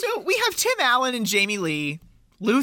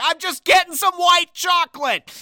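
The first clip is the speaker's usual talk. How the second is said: mock irritated Luther; 9 semitones higher, 5 dB louder than usual. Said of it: "And he's like".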